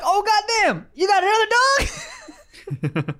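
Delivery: very high, drawn-out pet voice; high comic voice breaking into laughter; Greta. Acted. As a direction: squeal it in mock shock. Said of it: "She be like".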